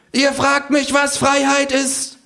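sehr hohe Stimme, rhetorisch fragend; Känguru. Acted. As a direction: wie ein hoher Volksredner vom Tresen. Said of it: "ruft das Känguru". (pathetische Känguru-Rede)

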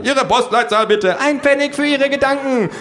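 very high, loud questioning voice; shrill voice from a survey mob; badgering pollster. A narrator's reading.

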